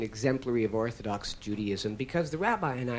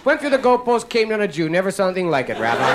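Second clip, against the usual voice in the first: loud, high play-by-play announcer; excited sportscaster; 8 semitones higher, 8 dB louder, faster than usual. Pretend. Mock football commentator shouting the conversion play to the rabbi. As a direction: call it like a big touchdown replay.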